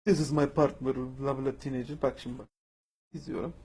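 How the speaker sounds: deadpan and quiet, no reaction at all; flat unmoved viewer. Shown as a demonstration. Moviegoer showing the flat reaction of knowing the twist, deadpan and quiet. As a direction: watch the screen blankly, unmoved. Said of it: "Bir daha yapıyorum arkadaşlar".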